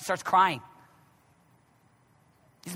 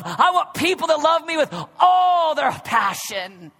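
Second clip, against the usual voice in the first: higher and loud, hammering each point; full-force preaching; 9 semitones higher, 6 dB louder than usual. Acted. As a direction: preach it out to the back row.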